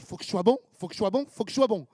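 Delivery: repeated nervous pep talk, rising each time; frantic self-pep talk. Retold or imitated. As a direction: psych yourself up before the big call. Nervous self-pep before call.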